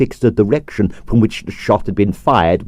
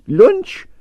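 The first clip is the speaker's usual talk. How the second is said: higher, eager one-word question; hungrily; 13 semitones higher than usual. Acted. Stroganoff eagerly invites the detective to lunch, higher. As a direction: ask it with a rumbling stomach. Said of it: "asked Stroganoff hungrily".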